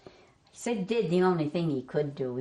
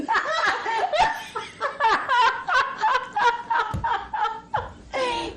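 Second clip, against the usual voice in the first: helpless laughter. Real, not acted. Speaker breaks up laughing.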